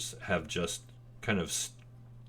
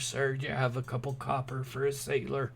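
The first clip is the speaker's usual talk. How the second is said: raised pleading beggar; beggar sailor voice; 6 semitones higher than usual. Acted. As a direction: ask for coins like a street beggar.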